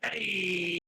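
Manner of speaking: long mock holler; mascot's distant call. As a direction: call out from beyond the door. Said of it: "the Dick Butt from outside go".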